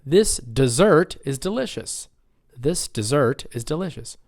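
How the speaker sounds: dessert as di-ZERT; second-syllable stress. Shown as a demonstration. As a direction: stress the end of dessert, for repeating. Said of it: "let's say it together".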